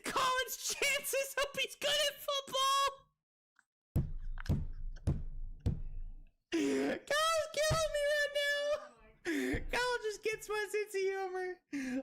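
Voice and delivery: very high, drawn-out near-crying hilarity; hysterical squeal. Real, not acted. Streamer says Kyle's killing him, hysterical high squeal. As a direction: squeak it out, helplessly cracking up. Laughing hysterically at own joke.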